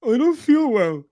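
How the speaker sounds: high, loud mock complaint; mocking whine. Streamer mocking a man who got hit, high whiny imitation.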